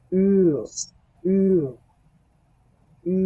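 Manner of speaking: উ said repeatedly, drawn; teacher drilling ü; German ü. Shown as a demonstration. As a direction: round lips for উ. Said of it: "আর এটাকে বলতে হবে কি".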